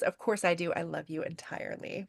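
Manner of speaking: soft, low romantic confession; imitating a hushed love vow; tender declaration.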